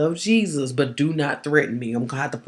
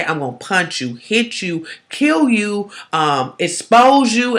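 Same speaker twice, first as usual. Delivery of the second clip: quoted threat; 5 semitones higher, 5 dB louder, slower than usual. Retold or imitated. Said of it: "comfortable for them to say".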